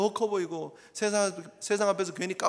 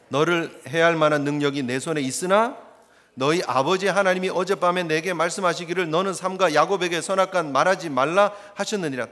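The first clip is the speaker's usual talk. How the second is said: louder, measured recitation; scripture reading aloud; 7 dB louder than usual. Acted. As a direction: read the verse together, steady. Congregation reads verse together.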